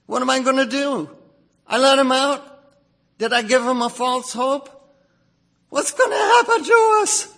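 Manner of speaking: high, rising frantic cry, shouting at the end; spiraling into panic as the enemy closes in; fearful panic. Retold or imitated. Moses fearing Pharaoh's chariots, high frantic panic. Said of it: "out of fear, do you say".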